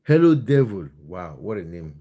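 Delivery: normal talking voice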